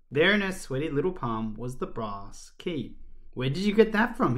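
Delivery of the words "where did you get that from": high-pitched demanding question; mildly indignant demand. A narrator's reading. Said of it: "he demanded".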